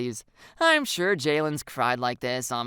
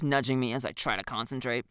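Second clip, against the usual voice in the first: usual speaking voice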